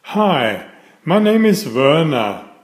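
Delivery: ordinary delivery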